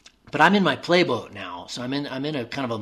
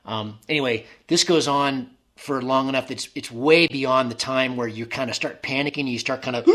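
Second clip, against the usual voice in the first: ordinary talk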